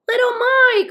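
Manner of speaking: very high, loud shout; elated high-pitched call; joyful cry. A narrator's reading. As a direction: cry out the name in delight. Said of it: "cried Moomin Troll again".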